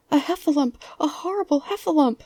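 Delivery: high, loud panicked character voice; crying out as Piglet. A narrator's reading. Piglet spotting a heffalump, crying out. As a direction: raise the alarm in terror. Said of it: "cried Piglet".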